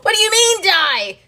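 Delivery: high, loud alarmed disbelief; the teen self hearing it must die, yelping in alarm; shocked outburst. Retold or imitated. Ego teen told to die, loud high shock. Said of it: "They go".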